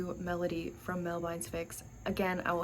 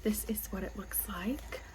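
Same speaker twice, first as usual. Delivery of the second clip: soft whisper to the camera; 6 dB quieter than usual. Real, not acted. Whispering to viewers while showing the volume.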